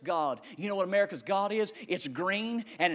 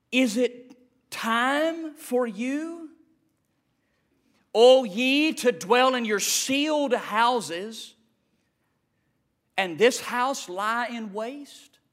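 scripture reading; much slower than usual. Acted. Reads scripture verse slowly.

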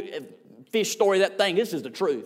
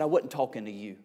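whispering; 9 semitones lower, 8 dB quieter than usual. Retold or imitated. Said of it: "she started whispering".

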